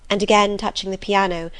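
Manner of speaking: ordinary delivery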